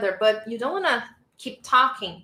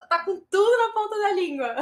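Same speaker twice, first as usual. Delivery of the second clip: high, quick, laughing through the words; laughing praise; 9 semitones higher, faster than usual. Real, not acted. Teacher teasing student who knows it all, laughing. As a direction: say it fast while cracking up. Joking about student on a roll.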